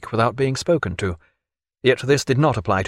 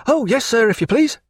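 put-on high, eager young voice; eager midshipman; 11 semitones higher than usual. A narrator's reading. Hungry midshipman accepting breakfast eagerly. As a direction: jump at the invitation. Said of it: "smelt the captain's bacon".

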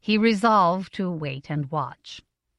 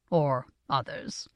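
softer, slower, lower add-on; conscientious afterthought; 5 semitones lower, 7 dB quieter, slower than usual. A narrator's reading. Father adds a scruple to his advice, conscientiously. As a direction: tack it on quietly and dutifully.

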